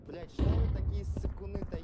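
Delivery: yelling furiously at teammates; loud angry outburst; angry shout. Real, not acted.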